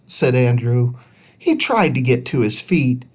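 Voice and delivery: plain reading voice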